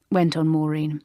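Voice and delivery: ordinary delivery, nothing marked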